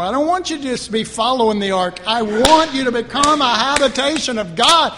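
loud, rising rebuke as God; forceful preaching. Acted. Preacher voicing God to the congregation, loud and rising. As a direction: build up to a full preaching shout.

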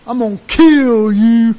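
very loud, slow menace; booming threat. Retold or imitated. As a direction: pronounce doom on Adam. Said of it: "God's only promise for Adam is".

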